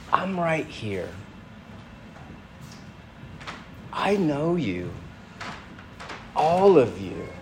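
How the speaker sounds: soft and gentle, barely above a hush; tender invitation. Retold or imitated. Jesus speaking tenderly to the listener, soft and gentle. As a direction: speak as if coaxing someone close, softly. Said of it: "The inclination is tenderness".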